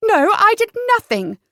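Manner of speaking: high, loud, shocked denial; startled, pitched right up; gasped. A narrator's reading. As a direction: protest innocence in alarm. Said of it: "she gasped".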